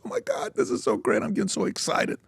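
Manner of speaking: high, soft falsetto swoon; hammed-up, very high-pitched excited voice; mock excitement. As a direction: squeal it softly, as a parody of being thrilled. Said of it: "That's what it sounded like".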